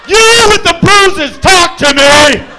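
loud, high, drawn-out shouting; hammed-up high yell; yelling at the shelter door. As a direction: bellow it like a crazed man at the door. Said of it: "walk right up to the front door and be like".

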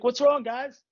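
high playful mock concern; teasing dad. Dad teasing his frightened sons, high and playful. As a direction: needle the kids with fake innocence.

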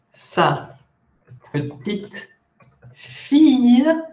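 "Sa ... petite ... fille" slowly, separated by long pauses; word by word. Shown as a demonstration. Teacher showing students the French phrase, word by word. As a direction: lift "fille" high after pausing on each word.

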